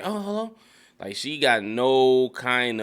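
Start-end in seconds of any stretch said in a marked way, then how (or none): 0.0-0.5 bright, eager high Oh, hello. Retold or imitated. Cassie picking up Nate's call, eager and high. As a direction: answer the phone far too eagerly. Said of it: "she's like".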